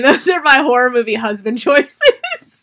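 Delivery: loud laughing declaration. Real, not acted. Cohost teases her crush, loud laughing claim. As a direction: blurt it out with a laugh.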